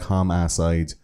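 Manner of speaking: homicide with a full middle "ah"; mispronounced homicide. Retold or imitated. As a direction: imitate the killer's "hom-AH-cide". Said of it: "So he's like".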